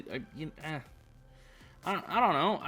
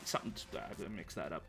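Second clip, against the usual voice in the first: ordinary talk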